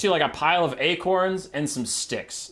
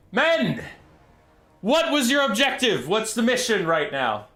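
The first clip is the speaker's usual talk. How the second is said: commander's address; 7 semitones higher than usual. Acted. General addresses stranded army.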